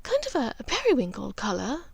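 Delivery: searching for the word, distracted; mother recalling a colour, dreamily; Narcissa lost in thought. A narrator's reading. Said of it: "lost in thought".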